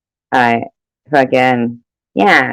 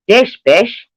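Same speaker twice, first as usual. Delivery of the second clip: playful rhyme; 8 semitones higher than usual. Pretend.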